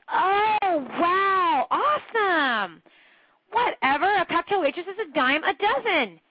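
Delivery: high, drawn-out fake "awesome", then a scoffing aside; mock gushing. Coach mocking fake awe at a cocktail waitress. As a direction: overdo the awe, then dismiss it.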